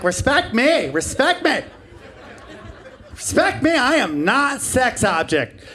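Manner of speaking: high, indignant mock-feminist demand. Mocking feminists demanding respect before covid, high hammed-up indignation. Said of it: "feminists like".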